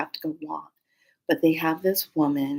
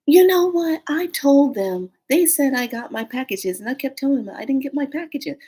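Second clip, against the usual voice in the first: exasperated lady; 7 semitones higher than usual. Retold or imitated. Retold exasperated lady recounting missing packages.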